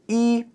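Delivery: "yi" held high and level; high first tone. Shown as a demonstration. Teacher showing learners number one, high first tone. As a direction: say "yi" on a sustained high, even pitch. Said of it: "That's a high first tone".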